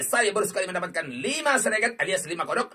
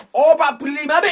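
loud, high burst of excitement; excited shout; 10 semitones higher, 12 dB louder than usual. Real, not acted. Streamer shouting at viewers as the jackpot lands, excited. Jackpot symbol appears on spin.

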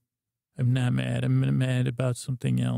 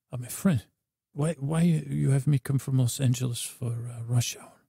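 put-on Antonio's accent. Antonio complains about the drive.

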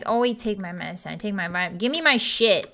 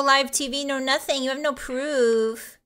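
put-on Valley Girl. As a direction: deny it like a sassy Valley Girl. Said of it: "five minutes of Valley Girl".

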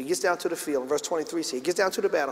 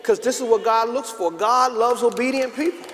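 higher, louder, urgent sermon delivery; forceful preaching; 6 semitones higher, 6 dB louder than usual. Real, not acted. Preacher urging young people to be obedient, forceful preaching. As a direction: drive it home to the congregation.

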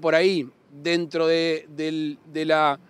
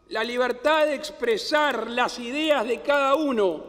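raised pitch, measured oratory; formal speech; 8 semitones higher than usual. Acted. Formal inaugural address.

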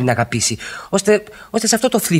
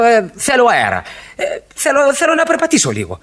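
pitched up, urgent and restless; agitated outburst; 12 semitones higher than usual. Acted. Husband pleading to his wife for air, agitated. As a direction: burst out, gasping for air.

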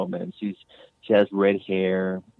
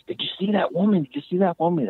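high-pitched startled cry, retold; a jolted, frightened wife calling out in a much higher voice; 10 semitones higher than usual. Wife startled awake by ghost.

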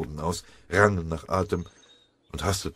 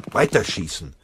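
loud shouted order to the gunner; barked drill commands; 3 semitones higher, 5 dB louder than usual. A narrator's reading. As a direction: yell it over the gunfire. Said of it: "brüllte der Feldwebel dem M-G-Schützen zu".

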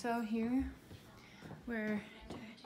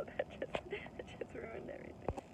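whispering; 11 dB quieter than usual. Real, not acted. Aside after a flub.

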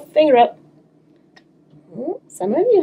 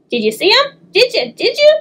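rising, higher and higher pestering; eager questions; 10 semitones higher than usual. Acted. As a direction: pester her excitedly. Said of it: "asked Peter".

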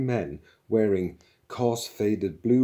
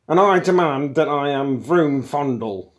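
loud, higher insistent shout; shouted demand; 7 semitones higher, 9 dB louder than usual. A narrator's reading. Younger intruder introducing himself, shouting. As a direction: shout it as a formal protest. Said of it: "shouted the younger one".

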